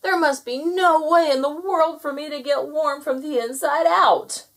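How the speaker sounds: high, loud lament; shouted. A narrator's reading. Gnome who cannot get warm, shouting. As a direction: shout the despair out to the world. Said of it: "he shouted".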